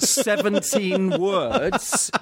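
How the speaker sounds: giggling through a high, slow line; laughing. Real, not acted. Laughing while telling of a failed Welsh accent. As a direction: laugh it out at your own flop.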